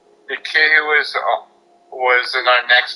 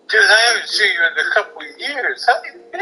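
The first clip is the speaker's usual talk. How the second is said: high, thrilled catch-up; excited greeting; 7 semitones higher than usual. Retold or imitated. The old camp friend greets him, high and thrilled.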